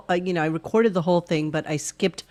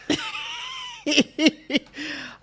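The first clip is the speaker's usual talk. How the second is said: high laugh; 3 dB quieter than usual. Real, not acted.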